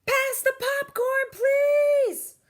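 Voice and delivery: very high, loud shouted request. Acted. Girl at the movies asking for popcorn, shouting. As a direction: shout it across the dark cinema.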